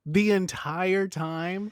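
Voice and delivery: slow, high-pitched questioning disbelief; incredulous. Real, not acted. Co-host balking at feedback about the show, incredulous. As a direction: stretch it out as you balk.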